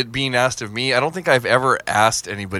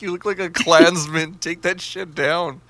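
giggling through the words, slowing at the end; laughing tease; 5 semitones higher than usual. Retold or imitated. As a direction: tease her while cracking up.

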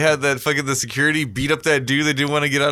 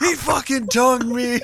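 mock shocked victim; 10 semitones higher than usual. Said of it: "making out with the air marshal".

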